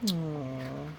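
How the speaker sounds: plain reading voice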